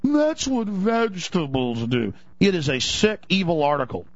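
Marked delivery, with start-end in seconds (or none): 0.0-2.2 slow, drawn-out "That's what vegetables do." in an eerie processed voice; spooky slowed-down echo of "That's what vegetables do."; slow sinister mocking repeat. Shown as a demonstration. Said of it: "See, redefining now".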